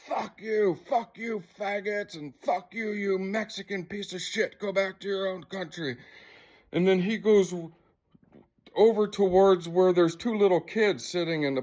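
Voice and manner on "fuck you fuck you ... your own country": mild mock yell, higher and softer; imitated angry rant. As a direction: imitate an abusive man's tirade, held back in volume. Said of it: "the man who's causing all the trouble's like".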